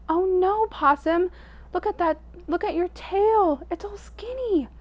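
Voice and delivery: high dismayed cry; shocked Wolf. A narrator's reading. Wolf seeing Possum's skinny tail, high and dismayed. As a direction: gasp it out, way up high.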